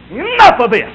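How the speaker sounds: high, loud burst, leaning on "Enough"; commanding shout. Acted. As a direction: call a halt with full authority. Imagined Jesus calling a halt.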